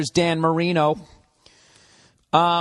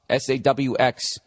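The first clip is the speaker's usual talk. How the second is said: ordinary delivery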